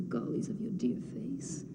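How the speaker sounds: soft, slow whispered comfort; tender whisper. Acted. Antigone comforting her nurse in a tender whisper. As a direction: soothe her tears gently.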